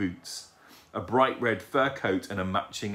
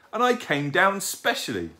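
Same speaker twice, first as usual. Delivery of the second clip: put-on high, louder childish grumble; cross; 10 semitones higher, 5 dB louder than usual. A narrator's reading. Owlet who came down specially, cross. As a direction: protest in a raised, put-out owlet voice. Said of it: "he said crossly".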